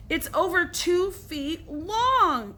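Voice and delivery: high, slow, whining yell. A narrator's reading. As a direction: drag it out in outraged disbelief. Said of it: "yelled Billy".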